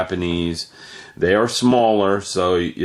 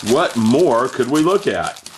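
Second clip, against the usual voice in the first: pitchman voice, lightly done; a hint of a TV salesman hyping; hard sell ad; 5 semitones higher than usual. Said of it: "I'm trying to sound like a car salesman".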